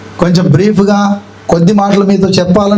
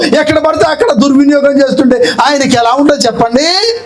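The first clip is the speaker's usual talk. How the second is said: fiery preaching; 5 semitones higher than usual. Acted. Pastor rebuking false preachers, impassioned preaching. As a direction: preach it hard, rebuking misuse of the name.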